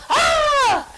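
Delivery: sharp high, loud scream of fright; a driver shrieking at a shadow, mock panic; startled scream. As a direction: yelp as if a deer leapt out. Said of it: "And you go".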